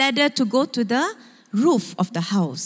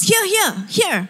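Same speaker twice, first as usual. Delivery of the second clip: mock shout; 9 semitones higher than usual. Abu on the roof calls his friends over, mock shout. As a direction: call friends over in a high, contained shout. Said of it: "And then he shouts".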